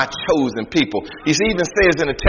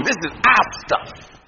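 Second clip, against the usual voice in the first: mock defiant people; 7 semitones higher, slower than usual. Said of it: "they come back and say".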